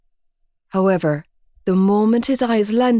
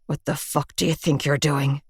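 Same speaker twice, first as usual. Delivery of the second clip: lower, softer menacing snarl; Joey snarling; 4 semitones lower, 5 dB quieter than usual. A narrator's reading. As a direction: growl it at her, contained. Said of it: "Joey snarled".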